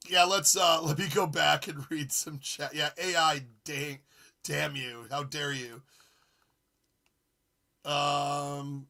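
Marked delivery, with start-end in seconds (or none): none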